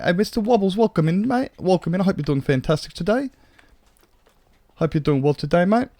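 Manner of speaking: a cheery Brit hamming up the welcome; British accent. Pretend. Greeting a viewer in a hammed-up British accent. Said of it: "that's enough British now".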